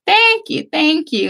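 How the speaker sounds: high, bright-pitched Texas mom thanking; gracious high thank-yous from a proud mom; mock-gracious Texas mother. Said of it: "And she just like".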